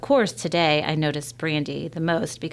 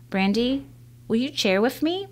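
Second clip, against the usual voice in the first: put-on a girl trying her careful best English, a touch higher; 7 semitones higher than usual. Girl offering to share lunch with Brandy, careful English. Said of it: "I say in my best English".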